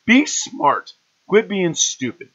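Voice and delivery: high, loud, exasperated rant at fans; heated rant. Real, not acted. Lecturing Browns fans on rooting for Baltimore, a loud rant. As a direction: lay into them, voice climbing and loud. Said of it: "Okay, I'm done".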